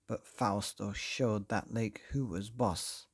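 ordinary delivery

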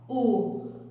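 ordinary talk

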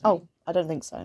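usual speaking voice

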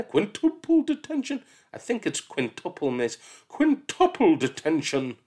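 high, loud, building punishments; escalating exchange. Acted. Teacher and Mark argue, detentions escalating. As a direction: raise the stakes each line.

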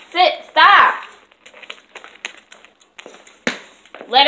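loud, high scolding of dog; yelling at dog. Real, not acted. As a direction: make the dog drop it. Yelling at dog to drop toy.

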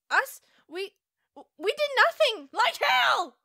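high-pitched baffled protest; indignant disbelief. Acted. Companions answering Sips's accusation, high indignant protest.

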